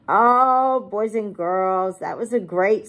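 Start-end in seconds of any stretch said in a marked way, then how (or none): none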